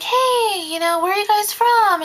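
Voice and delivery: friendly nanny voice. Retold or imitated. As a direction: sound eager to please. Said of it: "And I'm like".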